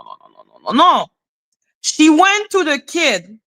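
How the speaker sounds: ordinary delivery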